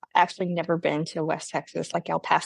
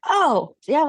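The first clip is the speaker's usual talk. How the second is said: higher imitated kid's "oh"; curious kid; 8 semitones higher, 3 dB louder than usual. Kid reacting to hearing Texas, higher voice. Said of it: "he's like".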